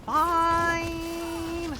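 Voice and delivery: long, held, high and loud mock huff; grudging surrender. Lucanus giving in to Rook, drawn-out grudging surrender. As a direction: stretch the word out in comic protest.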